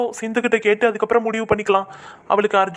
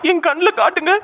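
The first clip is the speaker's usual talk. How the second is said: anxious calling out; 7 semitones higher than usual. Acted. Father anxiously asks for daughter.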